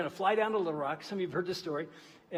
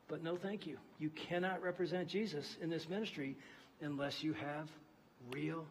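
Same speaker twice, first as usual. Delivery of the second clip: hushed earnest preaching; 10 dB quieter than usual. Acted. Preacher to congregation, hushed conviction. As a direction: pull them in with quiet conviction. Quiet closing sermon.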